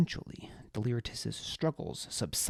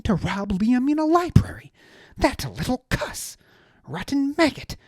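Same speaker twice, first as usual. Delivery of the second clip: high, loud, outraged aristocrat ranting; pompous little lord, higher and louder, furious; indignant high-voiced noble; 9 semitones higher, 9 dB louder than usual. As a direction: spit out the betrayal in a high indignant voice.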